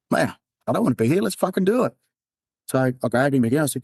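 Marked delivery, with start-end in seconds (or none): 0.1-2.0 quick, then high pushing yell; fast yelling dare. Retold or imitated. Cop at the cliff edge daring friend, fast yell. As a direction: snap the dare at him. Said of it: "I was just yelling at him".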